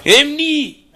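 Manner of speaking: loud taunting call; calling from far away. Acted. Hunting friend, calling out.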